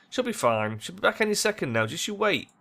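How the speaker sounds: rising to a high, forced confidence; brave face. Acted. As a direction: reassure bravely, pitch climbing after the start. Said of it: "puts on a brave face".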